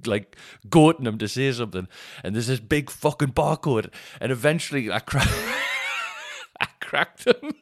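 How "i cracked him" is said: high laughing shout; real delighted laughing shout; shrieking laugh. Real, not acted.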